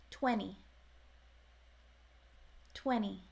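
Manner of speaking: Twenty said as twenny; Twenty with no T sound, N straight into the ending; skipped T. Shown as a demonstration. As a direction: drop the T after the N in Twenty. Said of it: "the T gets skipped".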